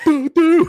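high, loud cartoon shot noises; squeaky doo-doo gunfire; mock gunshots. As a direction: pop off two silly shots. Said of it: "And they're".

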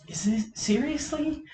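high-pitched incredulous question; retold disbelief. Reacting to being caught, retold.

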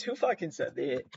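ordinary delivery

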